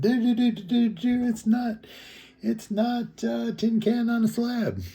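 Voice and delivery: high-pitched, singsong mimicry of self-justification; mock defensive protest. Vlogger imitating a man defending his tin-can home, mock indignant. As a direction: rattle off the homeowner's defense in a high mocking voice.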